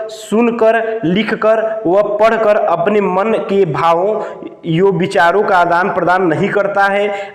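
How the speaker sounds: usual speaking voice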